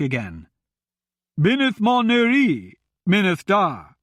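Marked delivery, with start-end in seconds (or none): 1.4-4.0 put-on high, loud call in old speech; shouting louder. A narrator's reading. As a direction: raise the voice so the beast hears. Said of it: "Myron shouted louder".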